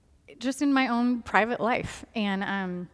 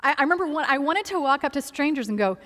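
normal talking voice

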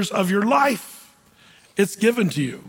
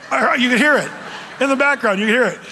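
ordinary delivery

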